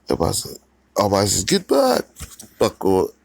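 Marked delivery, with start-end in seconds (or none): 1.4-2.0 alarmed yell. Acted. Crowd warns of explosion.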